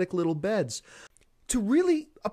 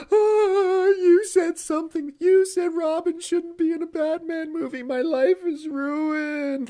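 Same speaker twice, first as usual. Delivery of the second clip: much higher and louder, whining, slowing at the end; mock wounded fan; 9 semitones higher, 6 dB louder than usual. Imitating a fan offended about Robin, melodramatic whining. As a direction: overdramatic hurt fanboy whine. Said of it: "take it to great personal offense".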